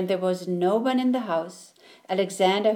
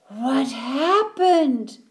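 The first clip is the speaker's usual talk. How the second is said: slow, startled question, a touch higher; surprised; 5 semitones higher, 4 dB louder, much slower than usual. A narrator's reading. Alexander finding his friend in the toy box, surprised. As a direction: ask in dismay. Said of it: "said Alexander, surprised".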